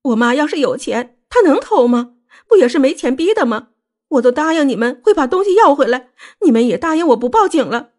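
high-pitched wailing grievance; distraught, accusing wail; loud crying. A narrator's reading. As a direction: protest tearfully in a high voice. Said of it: "穆小薇大哭道".

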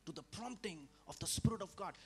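ordinary talk

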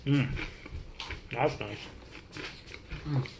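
chewing while talking; words through food; mouth full chewing. Real, not acted. Seen 1: Bites pizza at -3s; lips moving, chewing throughout labelled words.

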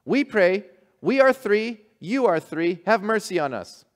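high, loud, earnest simple voice; eager fishermen. Acted. Fishermen proudly telling the bishop their prayer. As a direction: proudly recite like simple island folk. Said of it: "What do you say then when you pray?".

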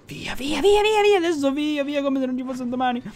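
high, excited rush to get away; frantic urging. Real, not acted. Gamer fleeing with the bounty, high frantic urging. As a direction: urge a getaway in a high voice. Urges himself to flee at once.

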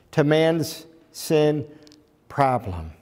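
ordinary talk, nothing marked

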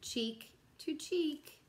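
slow, gentle storybook read with long pauses; reading to children. Acted. Teacher reading a bedtime picture book to kids, slow and gentle. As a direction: lull the little ones toward nap time.

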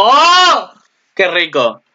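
high, loud gleeful cry. Retold or imitated. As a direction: relish it with glee. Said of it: "y yo digo". (exclamación aguda y fuerte de gozo)

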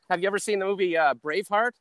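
ordinary talk, nothing marked

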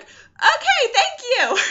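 very high, loud, jittery thanks; retold nervous squeak. Told the imam is coming, nervously squeaked. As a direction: squeal it in mock nerves.

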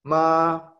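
MA held high and level; first tone, a long high level MA; high sustained tone. Shown as a demonstration. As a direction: sing MA on one high, even note. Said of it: "es alto y sostenido".

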